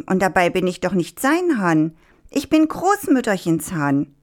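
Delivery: high-pitched, affronted protest; indignant goading. A narrator's reading. Rooster in the fox's grip provoking him, indignant.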